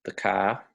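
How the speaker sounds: car said as 'kah'; Aussie 'kah' for car. Shown as a demonstration. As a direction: drop the R from car, Australian style. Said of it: "they don't roll their R's".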